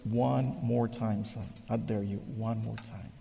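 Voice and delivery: a priest pressing gently, almost whispering; quiet priest. Retold or imitated. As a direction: priest quietly dares the young man again. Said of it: "the priest looked at him. He goes".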